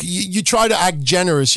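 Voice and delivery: normal talking voice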